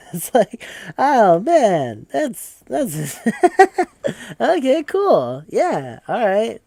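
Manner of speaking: high, loud laughing speech; words breaking up into laughter; cracking up. Retold or imitated. Player reacting to the biker's tears, laughing helplessly.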